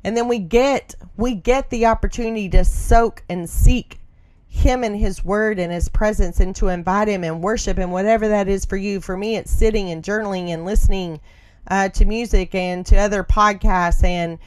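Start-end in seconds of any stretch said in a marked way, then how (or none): none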